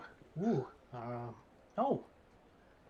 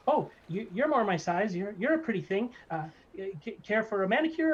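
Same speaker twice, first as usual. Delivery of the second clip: lightly higher, fawning little barber; barber gnome; 7 semitones higher, 5 dB louder than usual. Acted. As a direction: flatter them like an oily gnome barber. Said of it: "He says".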